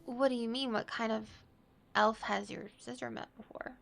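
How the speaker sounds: ordinary delivery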